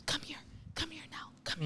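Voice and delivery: urgent hushed whisper. Retold or imitated. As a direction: whisper the command. Retold whisper of intruder.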